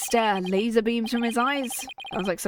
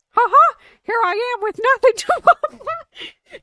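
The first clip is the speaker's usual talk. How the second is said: much higher, louder unthreatening laugh-talk; 7 dB louder than usual. Shown as a demonstration. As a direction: squeak it out as harmless as possible. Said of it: "the most high voice".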